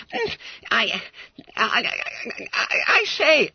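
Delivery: high, halting squeak; stammering. Acted. Fat schoolboy caught by his own deal, stammering. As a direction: stall nervously on each word.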